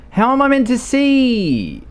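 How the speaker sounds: exasperated wail. Real, not acted. Driver can't see anything, high exasperated wail. Exasperated while driving blind.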